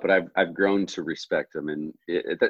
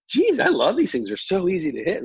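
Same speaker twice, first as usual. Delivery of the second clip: high, louder burst of delight; retold gushing; 11 semitones higher, 3 dB louder than usual. Player raving to tour pro about her clubs, gushing. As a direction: gush in a much higher voice.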